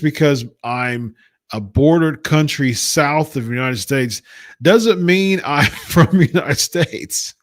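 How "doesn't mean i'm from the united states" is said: laughing. Real, not acted. Laughing through rant.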